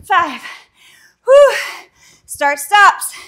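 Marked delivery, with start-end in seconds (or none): 1.2-1.6 sharp high exhale; breathless whew. Real, not acted. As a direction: blow out hard after the effort. Exhale after a hard set.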